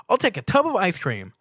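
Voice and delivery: very high, loud bubbly pony voice; Pinkie Pie. A narrator's reading. Pinkie Pie greeting Stuart with a loud high squeal. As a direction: squeal the welcome like an overexcited pony.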